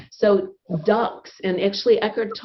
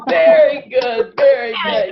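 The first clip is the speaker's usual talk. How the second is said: higher, louder, slow praise; cheering; 6 semitones higher, 8 dB louder than usual. Real, not acted. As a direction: cheer the class on. Teacher cheers the class.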